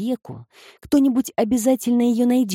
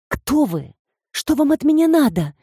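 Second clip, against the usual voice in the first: rising, sharp, unafraid questions; sharp demand; 7 semitones higher than usual. Acted. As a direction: snap the questions at him. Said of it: "резко спросила Люси".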